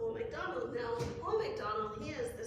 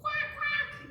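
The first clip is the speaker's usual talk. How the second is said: high, loud duck quacks; 6 dB louder than usual. Acted. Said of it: "what does a duck say?".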